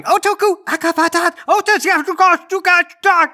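very high squeaky alien bickering; shouting aliens. Acted. Both aliens shouting at once, high frantic squabble. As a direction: yelp gibberish in tiny high voices. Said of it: "Now both of them are shouting".

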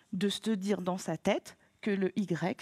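ordinary talk, nothing marked

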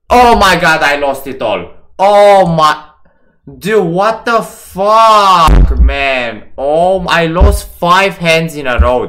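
loud, high, slow outburst; real angry disbelief; furious disbelief. Real, not acted. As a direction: rage at the losses. Losing five hands in a row.

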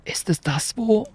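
plain reading voice

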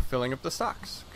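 usual speaking voice

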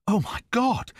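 high-pitched, gasped exclamation of horror; horrified gasp. A narrator's reading. Hearing a terrible scream on the moor, horrified gasp. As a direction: gasp it out in shock. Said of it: "I gasped".

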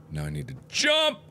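ordinary talk

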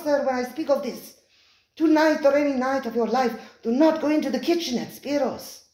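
high, pressing, pleading waiter; urgent waiter warning. Acted. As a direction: beg him with rising urgency. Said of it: "his voice rose slightly".

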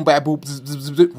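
quick bops and buzzing zzz noises; imitated clipper buzz. Comic showing Dominican barbers at work, imitated clipper buzz. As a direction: mimic electric clippers with the mouth.

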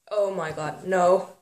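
usual speaking voice

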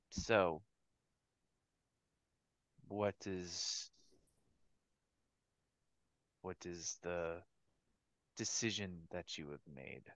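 low, quiet, menacing devil. Acted. GM voices the devil Fatala.

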